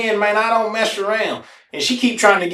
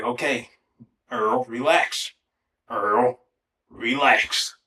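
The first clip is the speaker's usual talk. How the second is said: slow, soft, lower self-talk; self-calming; 6 semitones lower, 5 dB quieter, much slower than usual. Retold or imitated. Earl calming himself in deep water, slow and hushed.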